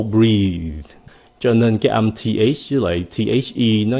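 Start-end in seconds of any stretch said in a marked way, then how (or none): 0.0-0.8 "breathe" with a buzzing, voiced th at the end. Shown as a demonstration. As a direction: let the throat vibrate on the end of "breathe". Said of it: "cái âm kia thì nó rung rung cái cổ". ("breathe" rung cổ ở âm cuối)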